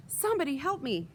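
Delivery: rising panicky plea for help; dying creature pleading for help; desperate plea. Acted. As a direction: beg anyone to save you.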